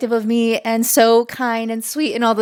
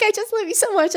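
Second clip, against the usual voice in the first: giggly; 10 semitones higher, 3 dB quieter, faster than usual. Retold or imitated. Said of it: "I'm just like".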